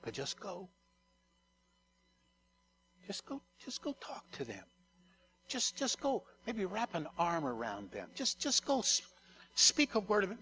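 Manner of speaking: soft, gentle coaxing of the Spirit. Acted. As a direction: softly urge them to just go. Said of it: "And the Spirit just gently says".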